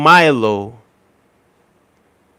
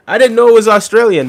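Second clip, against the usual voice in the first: surprised; 10 semitones higher, 10 dB louder than usual. Real, not acted. Surprised by a Milo question.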